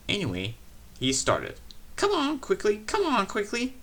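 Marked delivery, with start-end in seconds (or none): 1.9-3.8 high, hurried puffing chant; breathless little engine, much higher; puffing, eager engine. A narrator's reading. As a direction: urge them on, puffing high. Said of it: "he puffed".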